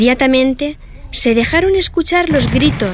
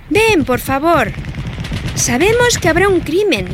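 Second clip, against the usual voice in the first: put-on begging girl; 7 semitones higher than usual. A narrator's reading. Marta coaxing her mother to watch, pleading. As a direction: draw out the please like a kid. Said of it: "le dijo Marta".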